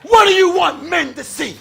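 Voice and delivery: fiery shouted preaching. Acted. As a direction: preach it hard to the pews. Preaching to the congregation.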